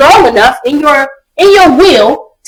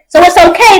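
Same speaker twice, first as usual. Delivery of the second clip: ordinary talk, nothing marked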